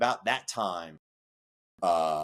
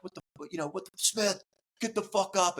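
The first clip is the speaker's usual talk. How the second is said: instructor yelling; 11 semitones higher than usual. Retold or imitated.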